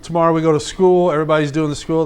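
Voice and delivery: ordinary talk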